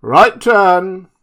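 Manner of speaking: loud, high marching order; shouting. A narrator's reading. Montgomery drilling his drunken band, loud and high. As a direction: bark it like a drill command. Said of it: "Montgomery's voice shouting".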